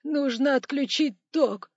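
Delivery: high, slow wailing; weepy high-pitched cry; full sobbing. A narrator's reading. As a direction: confirm it while bawling. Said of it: "уже вовсю рыдал начальник кассы".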